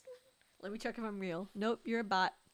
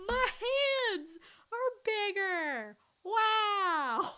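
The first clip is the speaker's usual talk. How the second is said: high, loud, drawn-out wow; mock amazement; 10 semitones higher, 6 dB louder, much slower than usual. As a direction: imitate someone hugely impressed, stretching the words. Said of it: "I like how he was like".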